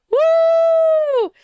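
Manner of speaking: loud, very high "woo"; long squealed cheer; celebratory whoop. Real, not acted. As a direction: whoop at full voice. Cheering the new year.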